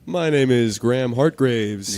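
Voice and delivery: loud, slow bass voice; loud, drawn-out self-introduction with bass. Real, not acted. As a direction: put some big bass in it. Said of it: "talk with bass in your voice".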